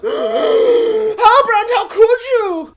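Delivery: high, loud mock heartbreak; betrayed cry. Galadriel crying out at her betrayer. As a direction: cry it out, hurt and shocked. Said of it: "Galadriel will then be like".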